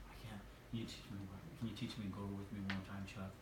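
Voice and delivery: hurried, low, very soft pleading; Mike's timid mumble. Retold or imitated. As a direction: mumble it fast with zero self-esteem. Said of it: "that's how he talked".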